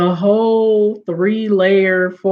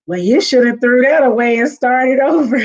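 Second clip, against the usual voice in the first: loud, higher blunt retort; imitating a tactless aunt, no filter; mock blunt put-down; 5 semitones higher, 4 dB louder than usual. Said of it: "no filter".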